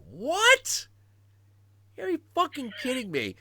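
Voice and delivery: high-pitched outraged disbelief; reenacted high, loud astonishment; incredulous. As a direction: squeal it in hammed-up shock. Retelling own disbelief at casting news.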